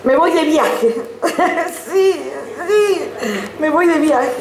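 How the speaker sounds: high, giggly announcement; giddy laughing. Acted. Giddy confession of a trip.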